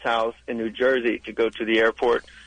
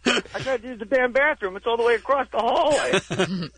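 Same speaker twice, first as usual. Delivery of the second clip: high-pitched, baffled, griping dad; exasperated dad; 8 semitones higher than usual. Acted. Comic mimics his lost dad at a crayon factory, exasperated and high. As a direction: play a worked-up dad complaining, pitched way up. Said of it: "My dad's like".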